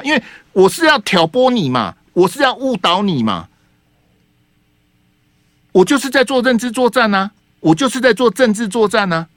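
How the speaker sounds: playing the schemer owning up with a sneer; mock TV station confession. Host mocking Sanli as it gloats over misleading viewers, mock confession.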